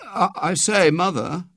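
high, faltering call for attention; anxious. A narrator's reading. Boy trying to stop his mother, anxious. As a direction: stumble at the start, mildly worried. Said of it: "said William anxiously".

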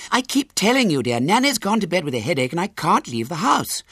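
high-pitched exasperated mother; passionate arguing. A narrator's reading. Mother telling Henry she can't leave the house, exasperated insistence. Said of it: "raised in passionate altercation with his mother".

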